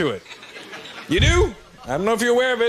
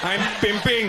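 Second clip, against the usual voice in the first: put-on Indian accent. Indian guys trying to be hip in a club, Indian accent. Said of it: "what their accent sounds like".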